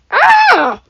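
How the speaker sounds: piercing high shriek of fury; witch shriek. Acted. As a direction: shriek in rage, very high and loud. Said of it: "the witch shrieked".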